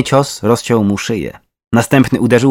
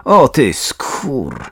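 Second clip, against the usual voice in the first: slow, drawn-out curse; angry, emphatic swearing; cursing; 3 semitones higher, much slower than usual. A narrator's reading. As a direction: stretch the insult, then cut it off. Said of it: "znów popłynęła niecenzuralna wiązanka".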